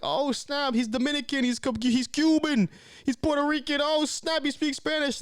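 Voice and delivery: high, excited chatter; mock excitement, voice way up; excited church members. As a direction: squeal it like thrilled gossipers. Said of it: "They were all excited, like".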